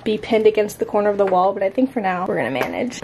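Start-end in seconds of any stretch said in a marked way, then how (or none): none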